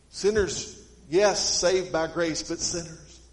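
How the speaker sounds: loud, slow, emphatic sermon delivery; fervent preaching. Acted. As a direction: drive each phrase home to the church.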